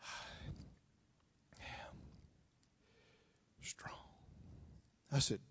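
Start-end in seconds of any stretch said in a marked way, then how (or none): 0.0-3.9 faint breathless whisper; barely voiced, near-silent whisper. Sick woman whispering from her bed. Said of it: "Only she said it in a bare whisper".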